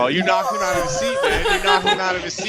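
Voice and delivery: high, loud laughing exclamation; cracking up at a friend. Real, not acted. Host laughing at cowboy outburst.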